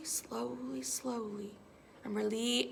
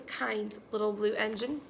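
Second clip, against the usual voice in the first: ordinary delivery, nothing marked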